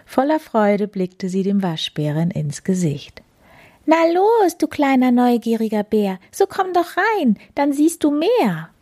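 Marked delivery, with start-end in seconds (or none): 3.9-8.7 hohe, lockende Katzenstimme; fröhlich und hoch locken; Plapperkatze. Acted. Katze lockt den Waschbären. (verspielte hohe Katzenstimme)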